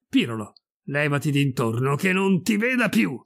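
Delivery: angry dismissal, rising in pitch; furious. Acted. Enraged priest sending Pirolo away, furious. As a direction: drive them away in a temper. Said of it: "perse il lume degli occhi".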